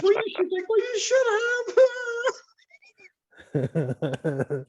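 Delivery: put-on high whiny sob of a child; mock crying daughter. Retelling daughter's tearful reply to dad, high mock sob. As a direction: whimper it like a hurt little girl.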